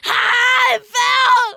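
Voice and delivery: high crying screams; being chased. Real, not acted. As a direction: scream in panic after falling. Falls while chased in game.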